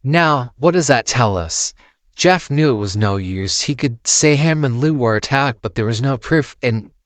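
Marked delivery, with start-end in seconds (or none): none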